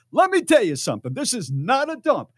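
loud, high, indignant mock retort; full-volume comeback. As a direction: blow up as the fed-up husband. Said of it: "but he lowered the volume".

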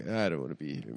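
low, soft reluctant mumble. Retold or imitated. Comic retells a rock star's interview answers, low impression. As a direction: sink low and quiet, as if fed up with interviews. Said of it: "every [name] answer is like".

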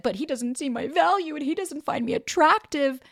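mock self-pity. Mock self-attack she could have made.

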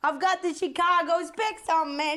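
hammed-up Chicago accent, loud. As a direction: boast in a mock Chicago accent. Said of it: "I'm from Chicago".

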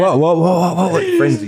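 stunned, rising what what what; shocked. Retold or imitated. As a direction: mimic shocked fans, pitching up. Mimicking fans' shocked reaction.

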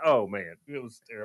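ordinary talk

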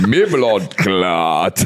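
very slow, low drawn-out patois growl. Shown as a demonstration. Showing learners a dancehall star's curse, long low drawl. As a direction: stretch the curse out like a dancehall star. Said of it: "when you say it in a [name]".

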